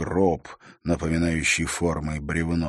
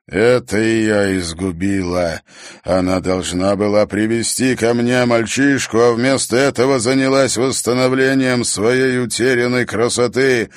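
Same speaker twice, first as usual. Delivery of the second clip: an angry voice from the mirror; echoing ethereal energy being; 4 semitones higher, 6 dB louder than usual. A narrator's reading. (гулкий голос из зеркала)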